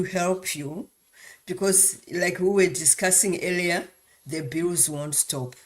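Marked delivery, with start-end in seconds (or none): none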